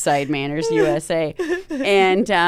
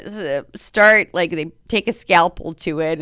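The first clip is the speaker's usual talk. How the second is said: ordinary talk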